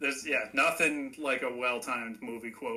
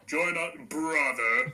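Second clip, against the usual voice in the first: a hint of earnest overacted menace; intent villain line; slower than usual. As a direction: lean into it like a solemn movie villain. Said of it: "real, like, intently".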